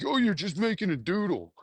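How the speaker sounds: ordinary delivery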